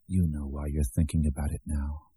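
low, soft voice in the head; needling inner voice. A narrator's reading. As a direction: murmur it like an unwelcome thought.

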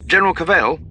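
high, clipped officer over the intercom; crisp military voice. A narrator's reading. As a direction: announce it like a precise pilot.

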